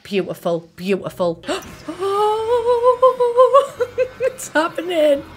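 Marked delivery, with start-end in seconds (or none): 1.5-4.1 long high gasp breaking into laughter; a loud squealing gasp that tips into a laughing sob; thrilled gasp. Real, not acted. Seance begins. 4.2-5.2 high, slow, laughing and half crying; a quavering whisper of delight; overjoyed disbelief. Real, not acted. Seance begins, overjoyed.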